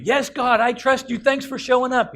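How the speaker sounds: high, loud, eager shout; urgent high-pitched yell; frantic man shouting to God. Acted. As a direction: shout up gratefully from the cliff. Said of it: "crying and screaming his full head off".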